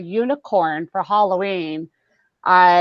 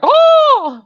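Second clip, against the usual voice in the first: startled oh; 8 dB louder than usual. Retold or imitated. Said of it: "I'm like".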